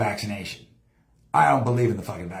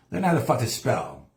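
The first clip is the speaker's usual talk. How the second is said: ordinary talk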